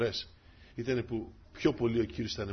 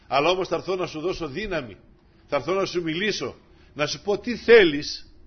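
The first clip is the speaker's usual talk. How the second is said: voice of the Lord; 4 semitones higher, 8 dB louder than usual. Acted. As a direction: speak as the Lord offering help. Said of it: "Έτσι μας λέει ο Κύριος". (ως ο Κύριος, με δύναμη)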